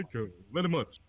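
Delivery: lightly imitated [name] catchphrase. Friend joins in on the [name] impression, lightly done. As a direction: have a go at an [name] impersonation. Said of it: "a really good [name] impression".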